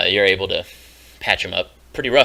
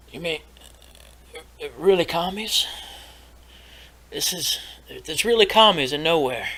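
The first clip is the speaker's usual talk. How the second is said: higher excited townsman voice; eager NPC voice; 8 semitones higher than usual. Acted. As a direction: perk up, disbelieving and hopeful. NPC bucks up, GM voices him.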